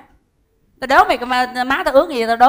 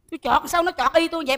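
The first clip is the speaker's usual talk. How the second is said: ordinary delivery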